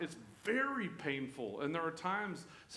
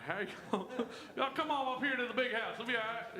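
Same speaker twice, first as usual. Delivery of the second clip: Southern Jesus laughing; 7 semitones higher than usual. Pretend. Jesus inviting people to the big house, laughing Southern accent. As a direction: chuckle through a homey Southern invite. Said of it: "If he was from the South".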